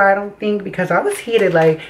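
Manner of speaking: ordinary delivery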